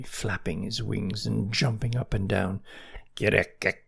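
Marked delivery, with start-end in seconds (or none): 3.2-3.8 short croaked frog call. Acted. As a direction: croak before objecting. Frog croak before objecting.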